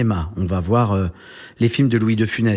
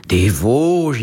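louder, drawn-out mimicked amazement; astonished echo; 3 semitones higher, 3 dB louder than usual. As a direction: echo it as an astounded professor. Professor echoes the answer, astonished.

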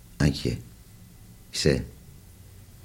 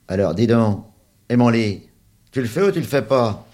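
put-on bossy child voice; 6 semitones higher, 6 dB louder than usual. A narrator's reading. Big boy bossing the little one.

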